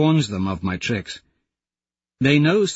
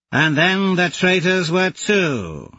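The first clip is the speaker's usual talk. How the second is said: slow, higher call across to the enemy; calling Aja; 8 semitones higher, 3 dB louder, slower than usual. Acted. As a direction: Aja calling out, cool and measured. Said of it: "she calls".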